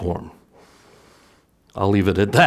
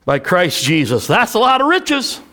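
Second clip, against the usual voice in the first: full-force preaching; 10 semitones higher, 3 dB louder than usual. Acted. Pastor preaching to the church about giving, full force. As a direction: preach it out to the congregation.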